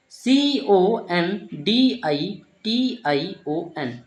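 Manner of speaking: slow letter-by-letter spelling of डीईसीआईएसआईओएन. Shown as a demonstration. Teacher spelling the word for students, slow and letter by letter. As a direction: spell each letter out slowly, one by one. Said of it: "इसे क्या पढ़ेंगे".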